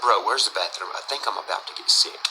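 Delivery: low, breathless whisper about to be sick. Retold or imitated. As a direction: whisper to your friend, nauseous. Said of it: "I said".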